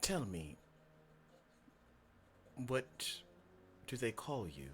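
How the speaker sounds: quiet, halting, unsettling voice; creepy voice. Acted. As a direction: draw the question out softly with eerie pauses. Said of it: "You got the creepy voice".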